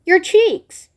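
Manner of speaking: high, loud alarmed cry; sharp frightened outburst; screamed. A narrator's reading. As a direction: horror at her daughter's face. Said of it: "screamed Missus Beauregard".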